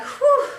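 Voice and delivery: high, loud relieved whew with a laugh; giddy relief after the chase, laughing whew. Retold or imitated. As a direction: let all the fear out with a laughing whew. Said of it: "we were like".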